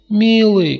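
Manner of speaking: высокий громкий девичий зов; крикни вслед любимому. A narrator's reading. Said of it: "крикнула Злата". (громкий женский окрик)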